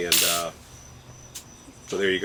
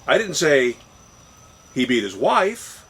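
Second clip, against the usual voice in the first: "wife" pitched up and punched at the end; stress on WIFE; 3 semitones higher than usual. Shown as a demonstration. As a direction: save the weight for the final "wife". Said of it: "depending upon where you put the emphasis".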